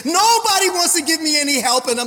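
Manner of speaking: acted-out lament of a struggling believer; high-pitched, building complaint; distressed complaint.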